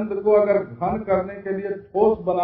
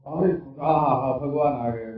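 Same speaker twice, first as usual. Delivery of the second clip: tender delight; 5 semitones lower, 5 dB quieter than usual. Acted. Devotee welcoming God back, in hushed tender joy. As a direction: sigh it out like a devotee in bliss.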